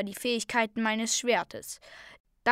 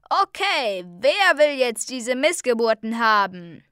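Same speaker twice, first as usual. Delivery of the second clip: loud auctioneer calling to a crowd; ringing voice; 2 semitones higher, 9 dB louder than usual. A narrator's reading. The voice offering captives to the crowd, loud calling. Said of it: "Da ertönte die Stimme wieder".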